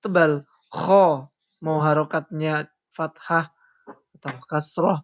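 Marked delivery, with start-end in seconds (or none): 0.7-1.3 Kho said heavy, thick and loud. Shown as a demonstration. Teacher gives students the correct thick reading. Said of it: "Yang benar, tebal".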